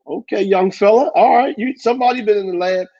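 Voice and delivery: high-pitched, playfully impressed greeting; impressed reaction. Retold or imitated. Host reacting to young tuner's new swagger, impressed and high-pitched. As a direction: hype up the newly confident youngster.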